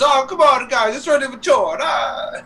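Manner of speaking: high-pitched cartoon protest; Luigi. Friend retelling a water prank on Luigi, high character voice. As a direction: imitate a pranked cartoon plumber, high and put-upon. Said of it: "And Luigi's".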